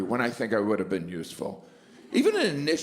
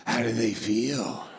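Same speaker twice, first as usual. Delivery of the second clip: creepy salesman; 4 semitones lower, slower than usual. Pretend.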